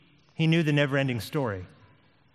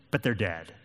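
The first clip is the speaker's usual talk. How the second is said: ordinary talk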